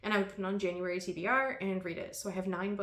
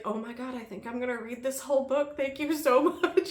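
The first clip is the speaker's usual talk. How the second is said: higher-pitched gushing, breaking into laughter; delighted friend's reaction; 5 semitones higher, 3 dB louder than usual. Retold or imitated. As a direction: imitate a friend gleefully thanking you.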